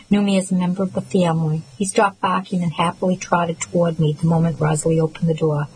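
ordinary delivery

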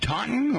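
Taunton high, quick, Tah-tn; clipped local Tah-tn. Shown as a demonstration. Host on his live radio show gives the local Taunton, high and quick. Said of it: "no L in there".

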